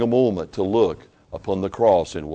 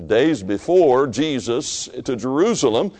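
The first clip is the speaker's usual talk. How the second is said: high, loud, rising pulpit preaching; impassioned sermon climax; fervent preaching; 10 semitones higher, 7 dB louder than usual. Acted. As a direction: preach it at full force to the congregation.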